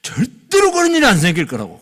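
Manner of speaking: imitated outburst, building to loud; mock flare of temper; angry protest. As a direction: retell an indignant objection, swelling on the second sentence. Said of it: "막 화를 벌컥 내면서".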